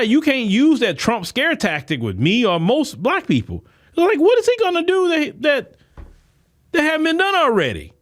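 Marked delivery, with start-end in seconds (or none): 4.0-7.9 near-falsetto outrage; incredulous squeal. Real, not acted. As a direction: throw it up high in exasperated disbelief. Same question repeated, escalated.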